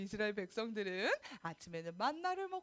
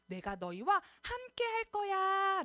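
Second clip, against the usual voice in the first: God's promise; 6 semitones higher, 3 dB louder than usual. Acted. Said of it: "하면서".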